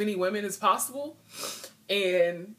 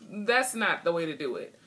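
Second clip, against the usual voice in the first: ordinary delivery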